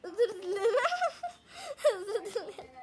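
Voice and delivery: giggling through the apology; laughing apology. Real, not acted. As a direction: apologize while cracking up.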